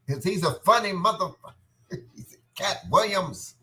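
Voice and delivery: high put-on voice, playful; sing-song silly character voice; comic character voice. Hyping Candyman and Cat Williams to listeners, high put-on voice.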